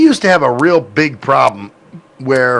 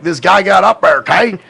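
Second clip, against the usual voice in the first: loud, higher country twang, words merged; thick southern accent; 5 semitones higher, 4 dB louder than usual. Shown as a demonstration. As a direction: exaggerate the Southern slur of "up there, okay". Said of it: "to me, sounds like".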